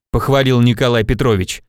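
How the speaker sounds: ordinary delivery, nothing marked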